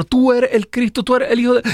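shrill it out like cornered demons; high, excited demon cries; demons crying out. Acted. Demons naming Jesus.

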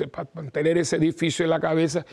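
usual speaking voice

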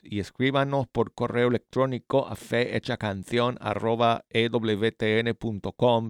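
ordinary talk

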